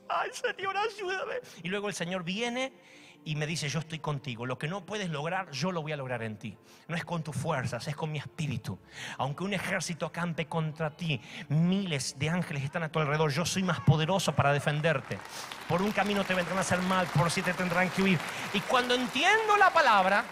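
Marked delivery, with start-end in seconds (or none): 0.1-1.4 much higher, plaintive plea; high, helpless cry to God; pleading cry. Acted. Believer pleads after rebuke. 3.7-18.2 lower, reassuring divine promise; lightly voiced God comforting, rising to power; calm promise growing firm. Voicing God's reply.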